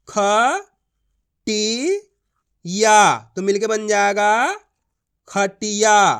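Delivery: ख, टी, या spaced apart, then joined into खटिया; letter-by-letter reading. Shown as a demonstration. Teacher demonstrating how to read खटिया, letter by letter. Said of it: "मेरा तरीका देखना है".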